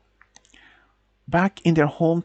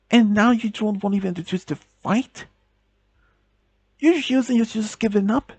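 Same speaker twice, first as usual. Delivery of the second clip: desperate pleading; 6 semitones higher than usual. Acted. Girlfriend pleads, upset, with fiancé.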